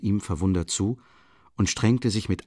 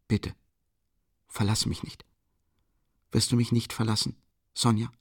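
pleading; 2 semitones higher than usual. Acted. Begging her not to leave.

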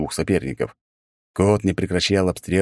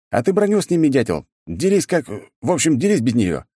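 mocking taunt; 8 semitones higher than usual. A narrator's reading. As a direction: taunt him from behind. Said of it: "послышался голос откуда-то сзади".

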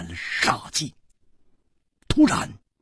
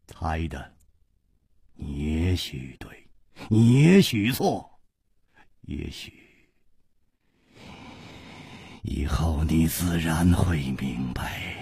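低沉缓慢，留长停顿; 轻声吊着话头，末句化作气声; 莫测的无花; 12 semitones lower, 6 dB quieter, slower than usual. Acted. Wuhua answers evasively. (低声含蓄，欲言又止)

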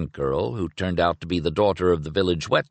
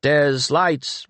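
calling out; 7 semitones higher than usual. A narrator's reading. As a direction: call for lights, raised and a little higher. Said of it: "Penrick's voice out of the shadows called".

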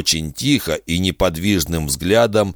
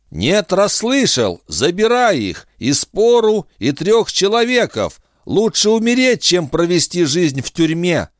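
defiant anger; 12 semitones higher than usual. Acted. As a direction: fling the refusal back in anger. Defiant refusal, rising fury.

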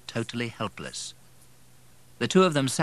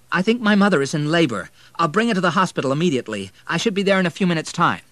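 high, loud, fast and anxious; urgent report; 9 semitones higher, 7 dB louder, faster than usual. A narrator's reading. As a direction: rush the news to the doctor. Son reports labour by phone.

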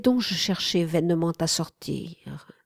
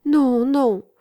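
higher, louder exclamation of denial; protesting cry; 6 semitones higher, 5 dB louder than usual. A narrator's reading. Slave assures his mistress she hasn't hurt him, crying out. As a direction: cry out a quick reassurance. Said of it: "m'écriai-je".

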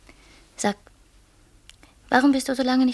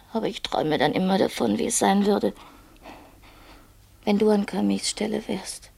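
ordinary delivery

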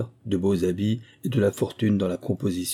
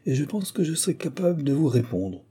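higher-pitched, keen reply; eager exclamation; 6 semitones higher than usual. A narrator's reading. Son takes up the squire's challenge, eagerly exclaiming. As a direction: exclaim it, ready to argue back. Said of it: "s'écria mon fils Moïse".

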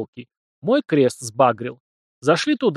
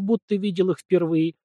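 plain reading voice

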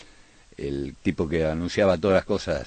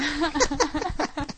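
laughing; 8 dB quieter than usual. Real, not acted. Laughs at own story.

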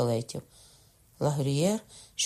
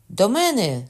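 loud high call for help; cry for help; 7 semitones higher, 7 dB louder than usual. A narrator's reading. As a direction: yell for help. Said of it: "скрикнув Ламоль". (гучний крик про допомогу)

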